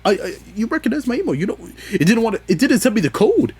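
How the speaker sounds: high, fast and frantic; frantic rant. Retold or imitated. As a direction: sputter in exasperation. Ranting about site not recognizing email.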